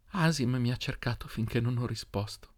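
soft, emotional relief; hushed, moved confession; tearful joy. A narrator's reading. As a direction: say it softly, overcome with relief. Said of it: "Stava piangendo e ridendo insieme".

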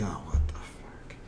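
whispered, slow and baffled; bewildered whisper. Retold or imitated. Kids in class react to teachers, whispering in disbelief. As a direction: breathe it out like a stunned kid. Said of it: "all the kids are like".

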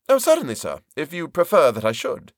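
bow out with overdone politeness; hammed-up deferential yes; voiced Turkey. Turkey obliges, voiced high.

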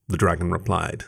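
ordinary delivery, nothing marked

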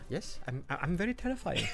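a hint of a soft, smooth villain; mock Christoph Waltz. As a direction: purr it with polite menace.

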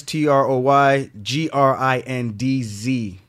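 slow letter-by-letter spelling. Shown as a demonstration. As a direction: spell each letter out slowly. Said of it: "spelling the handle slowly".

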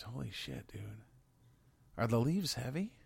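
normal talking voice

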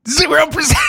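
high squeaky repeat of "Zero percent."; mock echo. Friend echoing a quiz answer, high and mocking.

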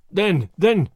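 angry, baffled. A narrator's reading. The German, at a loss for words, angrily baffled. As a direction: falter in mild angry bafflement. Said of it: "angry and baffled".

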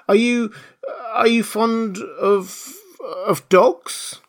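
high, halting, hesitant question; flustered Alice. A narrator's reading. Alice changes the subject for the mouse, faltering and high. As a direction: grope anxiously for a new subject. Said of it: "in a great hurry to change the subject".

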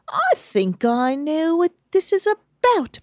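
high, slow, put-on British accent; James. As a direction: play a lofty British boy, stretching words out. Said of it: "And James ... goes".

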